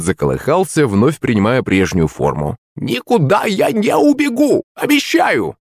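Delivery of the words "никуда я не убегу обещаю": high, slow pleading crone; old witch voice. Acted. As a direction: promise like a sly old hag.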